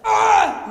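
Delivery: loud, sharp athlete's grunt from the gut; Serena Williams grunt. Shown as a demonstration. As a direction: grunt like a tennis player hitting. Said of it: "make the sound of Serena Williams".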